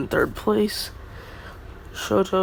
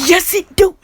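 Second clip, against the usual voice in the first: much higher, louder silly cartoon voice; goofy squeaky voice; 13 semitones higher, 12 dB louder than usual. Deku insisting the dog bites, loud high silly squeal.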